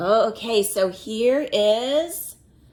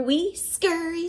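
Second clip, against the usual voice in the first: little ants, pitched higher; a hint of tiny ants, higher; 6 semitones higher than usual. Reading to a child, little ants answer higher. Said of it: "said the four".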